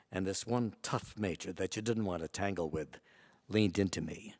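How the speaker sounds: ordinary talk, nothing marked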